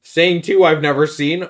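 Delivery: ordinary delivery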